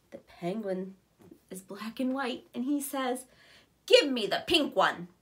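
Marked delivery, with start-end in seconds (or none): 3.8-5.1 rude demand. Acted. Penguin's rude demand voiced.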